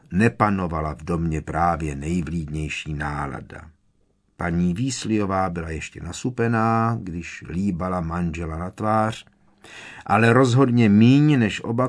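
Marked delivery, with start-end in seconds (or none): none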